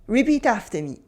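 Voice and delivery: usual speaking voice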